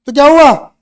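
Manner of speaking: loud, dismissive comeback; acted bold retort. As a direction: throw it back boldly, as a character would. Boss answers the timid appeal. (ज़ोरदार, बेपरवाह जवाब का अभिनय)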